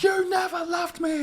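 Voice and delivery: hammed-up sulky kid shouting; whiny younger sibling. Kiwi playing New Zealand as the jilted little sibling, high wail. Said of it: "younger sibling who's like".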